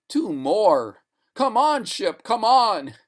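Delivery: high, loud, pleading cry to the sky; desperate call. Acted. Stranded man begging the rescue ship, desperate shout. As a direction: beg the ship to come.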